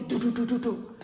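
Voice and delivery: loud vocal door-banging; police knock sounds. Retold or imitated. Naomi pounding on Ruth's door, loud knocking sounds. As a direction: bang it out like the police. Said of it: "bang on Ruth's door like she the police".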